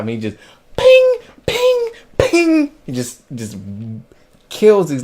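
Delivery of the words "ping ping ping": very high, loud "ping" for each blow; punchy high-pitched pings, spaced like blows; imitated striking sound. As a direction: ring out each hit as a sound effect.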